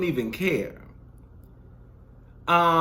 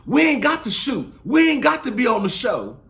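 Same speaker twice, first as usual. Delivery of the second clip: mock loud woman; 5 semitones higher, 6 dB louder than usual. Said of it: "she started talking about".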